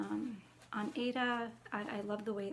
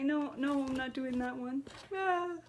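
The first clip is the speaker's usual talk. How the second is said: squeamish refusal; 3 semitones higher than usual. Real, not acted. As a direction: refuse it like it might bite. Refuses to stitch spiders.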